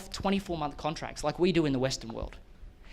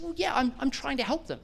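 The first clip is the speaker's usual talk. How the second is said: much higher, modest imitation of a humble client; Wendy, modest; 8 semitones higher than usual. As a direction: play her down, shy about her own work. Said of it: "And she said".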